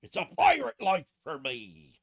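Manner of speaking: belted loud pirate declaration; hammed-up pirate. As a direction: boom it out like a jolly pirate captain. Launches into a pirate bit.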